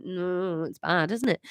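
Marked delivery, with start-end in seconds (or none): none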